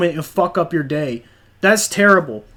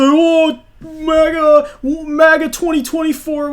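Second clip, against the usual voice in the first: much higher mock-tearful whine; mocking whiny crier; 9 semitones higher, slower than usual. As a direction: sneer it like a whimpering MAGA fan. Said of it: "crying like pussies and say".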